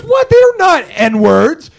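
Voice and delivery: loud, high, drawn-out imitation; mock indignant protest. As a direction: imitate her protesting, stretching it out. Imitates woman defending herself.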